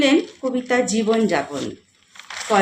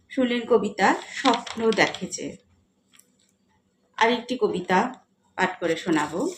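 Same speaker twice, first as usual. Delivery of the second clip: normal talking voice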